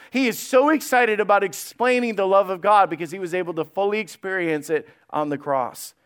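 ordinary talk

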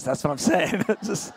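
ordinary talk

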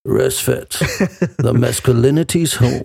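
low booming trailer announcer; movie trailer voice. Pretend. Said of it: "so eine richtig geile Trailerstimme".

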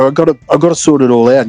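normal talking voice

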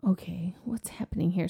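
soft, hushed, baffled; puzzled whisper. Retold or imitated. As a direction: whisper it in confusion. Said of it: "And I was like".

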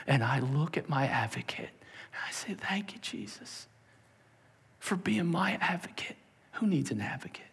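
soft whispered thanks; grateful whisper. Acted. Sinner thanking Jesus the advocate in a soft whisper.

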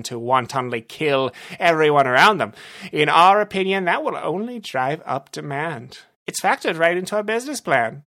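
boastful villain. Acted. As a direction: lay out the evil business plan proudly. Narrator voices Rumble.

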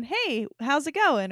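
slow, bright, friendly hello to a stranger; cheerful greeting. Retold or imitated. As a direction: greet a passerby in the aisle kindly. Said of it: "I could see myself just going".

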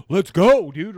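put-on hyped-up drunk shout of yes; eager shout. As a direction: jump at the chance, loud. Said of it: "Dude, I'm like".

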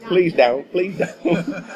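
high Southern plea, played for laughs; high-pitched Southern manager. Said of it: "he had a high-pitched voice and a southern accent".

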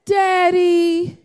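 called-out Daddy; child calling. Retold or imitated. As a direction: call him like a girl who wants something. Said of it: "That's how they get it".

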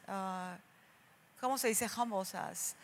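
ordinary delivery

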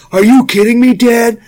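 high-pitched, indignant teenage protest, played for laughs; outraged Aiden. Son complains to dad about homemade soup, in mock outrage. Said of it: "Aiden was so mad".

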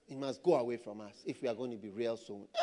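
hushed low warning; grave hushed repeat. Real, not acted. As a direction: drop right down to a quiet, earnest undertone. Repeats the warning, dropping to grave hush.

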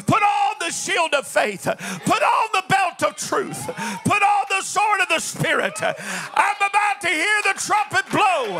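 rally the church like a drill sergeant; fiery preaching. Acted. Preacher calls soldiers of the cross.

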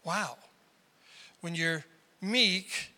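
ordinary talk, nothing marked

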